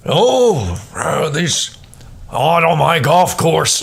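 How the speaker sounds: lightly voiced snooty golf club snob; a hint of a stuffy country club snob; Ted Knight impression. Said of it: "like to be Ted Knight from Caddyshack".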